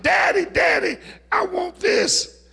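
put-on very high child's shout; high, insistent plea, slowing at the end; little Fred. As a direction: shout it like a boy desperate for a toy. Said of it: "and shouted".

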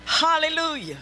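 ordinary delivery

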